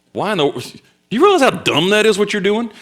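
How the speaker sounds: high, indignant telling-off; incredulous scolding, pitch jumping up; laying into them. Retold or imitated. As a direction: lay into a friend with exasperated disbelief. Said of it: "I would lay into them".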